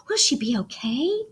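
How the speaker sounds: soft, breathless whispered question; worried whisper. Acted. Girl asking Daddy about her hurt bike, whispering. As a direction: whisper it, afraid of the answer.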